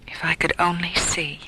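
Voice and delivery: slow, whispered lament; sad whisper. Acted. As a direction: sigh out the longing to see. Blind woman self-pity.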